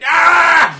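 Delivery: piercing high loud shriek; agonized scream. Pretend. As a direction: howl like a pug in pain. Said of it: "pet pug having an average day".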